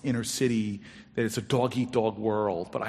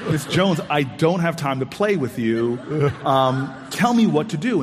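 louder and higher, out of patience; impatient and loud; 4 semitones higher, 6 dB louder than usual. Retold or imitated. Telling the teasing neighbour to stop playing, impatient and loud. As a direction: snap back at someone who's toying with you.

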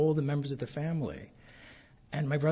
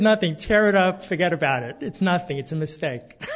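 louder, hurried dismissal of the letter; mother brushing it off; 4 semitones higher, 8 dB louder than usual. Retold or imitated. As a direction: wave it away like a nervous mother. Mother voiced dismissing the notices.

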